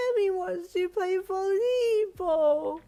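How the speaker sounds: high, loud, slow crying wail; wailing little girl. Acted. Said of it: "she wailed again".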